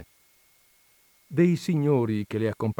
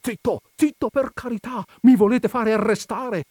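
high, urgent pleading; anxious hushing; 12 semitones higher, 6 dB louder than usual. A narrator's reading. Don Michele fearing arrest, hushing the coachman anxiously. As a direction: hush him in high-pitched alarm. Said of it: "mormorava don Michele".

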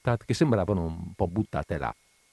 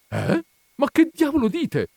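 very high, louder indignant question; baffled outburst; 5 dB louder than usual. A narrator's reading. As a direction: burst out in disbelief. Widow baffled by the pun. (sbalordita, voce acutissima)

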